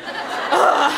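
put-on teen exasperation; exasperated ugh. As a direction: groan at everyone's nosiness. Frustrated at people's questions.

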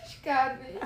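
loud, laughing mock bafflement; imitating a speechless friend, loud and giggling; mock baffled. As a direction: play the stunned friend who can't place you, laughing. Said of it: "Emma war richtig sprachlos".

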